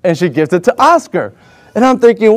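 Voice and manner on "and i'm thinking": ordinary talk, nothing marked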